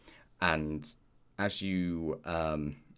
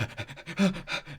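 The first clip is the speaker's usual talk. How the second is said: quick, high puffs of panting; breathless panting; 11 semitones higher than usual. Acted. Game master mimes the player running to reception, panting. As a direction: pant hard as if running. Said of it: "you're sort of running down, like, sweating".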